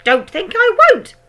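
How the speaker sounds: very high, loud hag shout. A narrator's reading. Grandma shouting at George, very high and loud. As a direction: bark at the boy. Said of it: "Grandma said".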